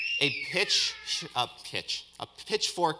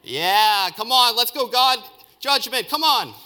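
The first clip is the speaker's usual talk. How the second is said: loud, high, urging cheers; acted fired-up shouting, climbing in pitch; frenzied crowd shout; 10 semitones higher, 11 dB louder, slower than usual. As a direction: egg God on like a rowdy mob. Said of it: "Israelites would have shouted".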